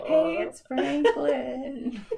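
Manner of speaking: very slow, high cartoon turtle voice; Franklin the Turtle. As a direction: drawl it out like a kids' cartoon turtle. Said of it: "called myself all the time Franklin the Turtle".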